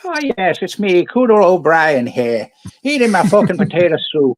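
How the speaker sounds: high, loud leprechaun voice. Pretend. Cooter O'Brien introducing himself to a friend, mock leprechaun voice. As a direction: pitch it up like a loud, hammy leprechaun. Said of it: "You sound like a leprechaun".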